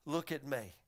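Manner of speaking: low, soft answer; quiet invitation. Acted. Jesus showing the way of God, soft and low. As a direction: drop right down after the pause.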